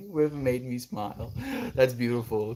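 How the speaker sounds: normal talking voice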